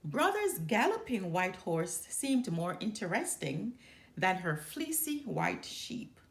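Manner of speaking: ordinary delivery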